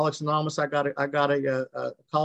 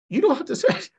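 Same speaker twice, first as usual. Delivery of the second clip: excited high quote; 3 dB louder than usual. Retold or imitated. Woman's joyful phone call, retold.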